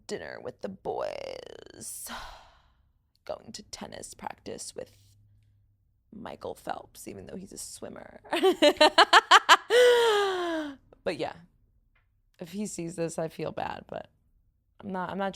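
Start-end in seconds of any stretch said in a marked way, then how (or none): 0.0-8.2 bad date's vocal-fry impression. Mocking her LA date captioning a photo, low croaky fry. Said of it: "I'm gonna explain his vibe". 8.3-10.7 high, loud burst of laughter; cackling laugh. Real, not acted. Host cracking up at her impression of a date, loud cackle.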